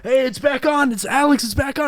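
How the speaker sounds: imitated dad calling out. Dad calls kid from the microwave as show returns, hollering. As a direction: call urgently across the house, as dad. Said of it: "And then he'd be like".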